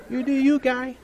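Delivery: high mock permissive voice, laughing; worldly attitude. Preacher imitating how the world talks to a guy, mock attitude. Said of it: "with that kind of attitude".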